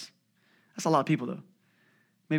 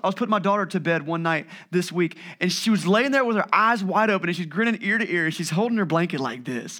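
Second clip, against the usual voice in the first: ordinary talk, nothing marked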